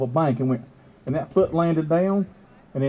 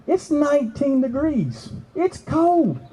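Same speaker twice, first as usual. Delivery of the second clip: high, drawn-out grumble; emphatic complaint; 9 semitones higher, slower than usual. Real, not acted. Investigator ready for the warm cabin, complaining. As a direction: push the pitch up in protest.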